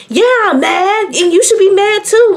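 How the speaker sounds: real heated comeback to the taunt; indignant. Real, not acted. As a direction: fire it back, offended and loud. Angry reply to classmate's taunt.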